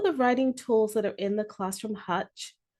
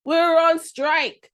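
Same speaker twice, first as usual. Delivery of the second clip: loud, slow, raised insistence; firm declaration; 6 semitones higher, 6 dB louder, slower than usual. Acted. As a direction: plant your feet and say it loud. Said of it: "Brown told him".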